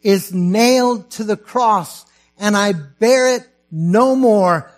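loud, high, slow declaiming that swells into a shout of praise; proclaimed hymn lines. Acted. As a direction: cry the hymn out as a triumph.

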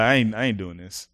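low, soft flat refusal; a low scared I ain't doing this; quiet refusal. Retold or imitated. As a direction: mutter it under your breath, scared. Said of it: "I'm like".

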